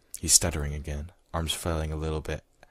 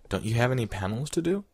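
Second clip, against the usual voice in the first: put-on higher-pitched girlish question, rising; a lightly put-on higher voice, asking; 7 semitones higher, 4 dB louder than usual. Fangirl asks him about his panels, questioning.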